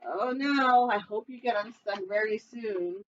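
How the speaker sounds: ordinary delivery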